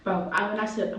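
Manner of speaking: ordinary talk, nothing marked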